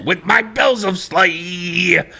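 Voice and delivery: slow, high cartoon Chicago singsong; drawn-out fifties Chicago cartoon voice; Chicago accent. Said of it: "my "Dachshund through the snow" voice".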